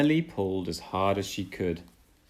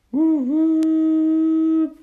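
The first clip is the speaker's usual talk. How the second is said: much higher, loud train whistle call; whistle imitation; 11 dB louder than usual. Thomas's whistle warning in the distance, loud high woo. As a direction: whoop it like a steam whistle.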